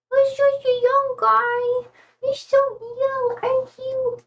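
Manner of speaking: high, slow cooing voice for the pet. Real, not acted. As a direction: coo sweetly to the little guy. Cooing baby talk to her pet.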